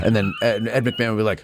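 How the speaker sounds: ordinary talk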